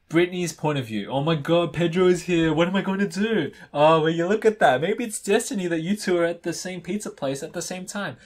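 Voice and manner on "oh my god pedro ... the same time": high, loud excited gushing; acted giddy excitement, pitched up; excited friend. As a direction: gush excitedly over spotting a crush. Excited friends spot the crush.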